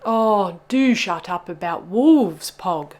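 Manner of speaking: loud, weighty, slow scolding; exasperated snap. A narrator's reading. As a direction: snap at the whiner, fed up. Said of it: "snapped Bubba".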